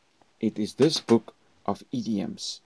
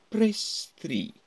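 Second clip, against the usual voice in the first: "press three" lifted higher with a roll; 11 semitones higher than usual. Shown as a demonstration. Teacher showing the roll on a menu option. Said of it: "There was a roll".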